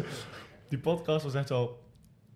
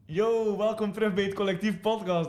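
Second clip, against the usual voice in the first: podcast host intro; 6 semitones higher than usual. Retold or imitated. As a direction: hype up the show opening. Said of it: "En dan moet ik zo beginnen van".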